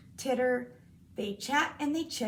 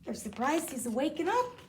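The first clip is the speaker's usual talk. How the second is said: ordinary delivery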